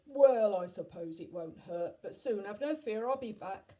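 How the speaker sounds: loud giant with a booming "Well"; loud, reluctant storybook giant; obliging giant. A narrator's reading. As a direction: agree grandly, in a big giant voice. Giant agrees to help children.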